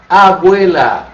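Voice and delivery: abuela said slowly and clearly, stress on the e; Spanish word, slow. Shown as a demonstration. Teacher presents the Spanish word slowly to the class. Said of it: "o que quer dizer abuela em espanhol".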